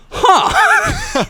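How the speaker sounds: very high, loud "huh"; mock surprise. Bosses finding their mistake in the paperwork, mock surprise. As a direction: imitate a squeaked, startled realisation.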